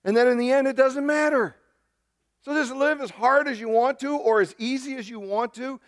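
high-pitched preacher's exasperation; exasperated. Real, not acted. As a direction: voice lifted high in protest. Exasperated preacher concludes it is pointless.

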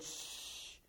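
long, soft unvoiced shush; hushing. Jesus holding the storm like a baby, hushing. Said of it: "held it up and said".

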